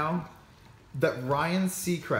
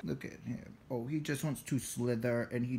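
whispering; 4 semitones lower, 9 dB quieter than usual. Real, not acted. Hushed over the shy snake.

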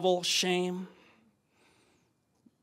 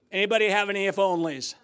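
usual speaking voice

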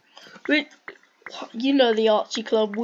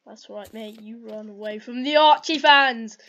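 ordinary talk

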